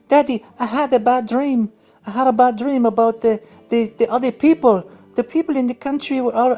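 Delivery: put-on high, whiny child voice; mock little boy. Young Justin telling Daddy his bad dream, mock whiny child voice. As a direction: pitch way up like a scared little kid.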